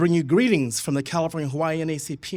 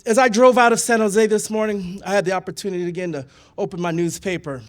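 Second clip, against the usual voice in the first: usual speaking voice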